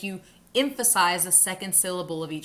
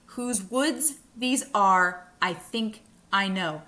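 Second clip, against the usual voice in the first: "Whose woods these are, I think I know" in slow two-word beats; broken down by feet; slower than usual. Shown as a demonstration. Teacher breaks the line down for students, slow iambic beats. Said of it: "if you break these poems down by line".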